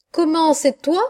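delighted cry. A narrator's reading. Poet greeting his visitor at the door, delighted cry. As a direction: exclaim in welcome as you greet a friend. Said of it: "cria Mistral en me sautant au cou".

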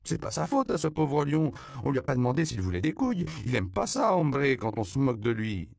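put-on high, loud, fast outraged child; indignant. A narrator's reading. Little girl defends the mocked lion, indignant. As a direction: protest hotly for the poor lion. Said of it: "Loupé s'indigna".

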